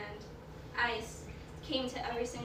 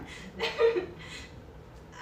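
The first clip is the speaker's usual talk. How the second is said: high, louder teary laugh; laugh through tears; 12 semitones higher, 7 dB louder than usual. Real, not acted. Embarrassed at crying before friends, laughing through tears. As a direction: break into laughter while crying.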